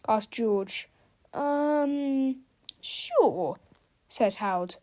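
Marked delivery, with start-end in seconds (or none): 1.3-3.6 hesitant, doubtful sure; unsure. A narrator's reading. Harold hides something from George, sounding unsure. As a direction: answer without conviction. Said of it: "he didn't sound very sure".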